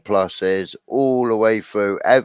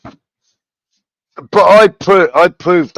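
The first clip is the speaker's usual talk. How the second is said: emphatic outburst; 10 semitones higher, 10 dB louder, much slower than usual. Real, not acted. Emphatic restart.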